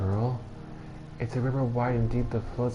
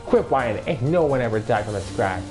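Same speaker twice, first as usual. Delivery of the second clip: scornful scold; 4 semitones higher, 6 dB louder than usual. Acted. Old woman scolds boy.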